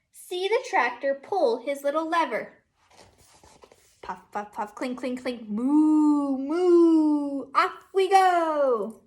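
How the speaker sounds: teacher reading Down by the Barn to her class, sing-song; reading to children. Acted. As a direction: read it like a storybook for little kids. Reads picture book aloud.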